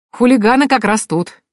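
high-pitched playful announcement; joking along. A narrator's reading. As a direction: chime in on the joke. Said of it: "поддержал шутку Маркус".

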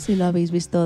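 usual speaking voice

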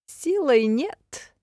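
slow, drawn-out, high sly voice; sly barbarian. A narrator's reading. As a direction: squint and draw it out slyly. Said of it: "прищурился тахариец". (хитрый варвар)

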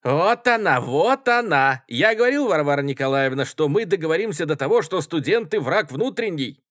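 high, loud, outraged outcry; shouting. A narrator's reading. Student protesting to the chairwoman, shouting. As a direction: shout it as if proven right. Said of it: "кричал студент в тужурке".

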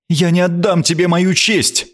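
defiant yet powerless; helpless yet haughty. A narrator's reading. Queen refuses to surrender her honour, helpless and haughty. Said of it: "беспомощно и одновременно надменно".

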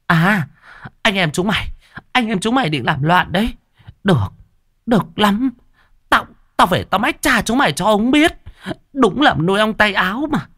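very high shrieking threat; yelling stepmother. Acted. Stepmother yells at the siblings, very high and threatening. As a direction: screech it at the siblings. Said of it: "Ả lại gào lên".

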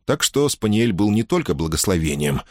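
ordinary delivery

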